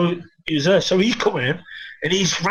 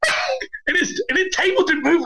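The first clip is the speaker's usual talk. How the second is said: much higher, laughing through the words; real laughter breaking into the telling; giggling so hard the voice jumps up; 10 semitones higher than usual. Real, not acted. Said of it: "I'm fucking crying".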